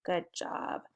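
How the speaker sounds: ordinary talk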